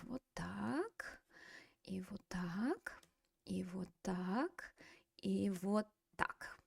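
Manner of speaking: soft unvoiced whisper, slow. Real, not acted. Teacher whispering while flipping to the textbook, softly. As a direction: breathe each "вот так" under the breath.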